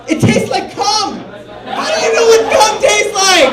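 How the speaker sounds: high, slow, laughing then shouted; disgusted Steve. Retold or imitated. Steve with beer dripping, high and laughing, then a shout. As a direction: cackle through it, then shout the question. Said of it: "Steve's going".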